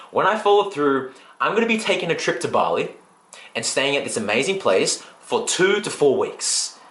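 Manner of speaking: ordinary talk, nothing marked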